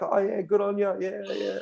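high, breezy fan voice; lightly voiced English fans not taking him seriously; fans brushing it off. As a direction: humour the rider. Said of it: "they're like".

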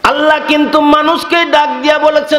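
high, ringing sermon chant; full-force preaching. Real, not acted. Preacher tells of God calling to man, high impassioned preaching. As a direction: proclaim it high on a sustained note.

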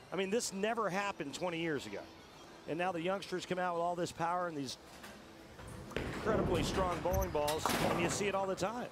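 ordinary delivery

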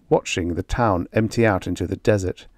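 usual speaking voice

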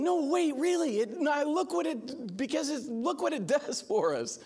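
high, eager, tumbling words; excited disbelief. Retold or imitated. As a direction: retell your own excited rush of protest.

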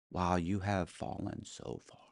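slow, hushed disbelief; stunned pity, imitated. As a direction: say it softly and slowly, as her appalled reaction. Ex-wife's stunned pity, acted.

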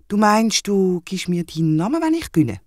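wide-eyed disbelief; astonished wombat. Acted. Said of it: "staunet der Wombat".

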